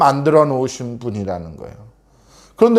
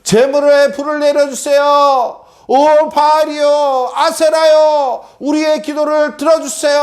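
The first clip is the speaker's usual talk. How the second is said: high-pitched chanting plea of idol priests; pleading prayer; 11 semitones higher, 3 dB louder than usual. Acted. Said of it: "뭐라고 기도했을까요?". (높고 길게 부르짖는 기도)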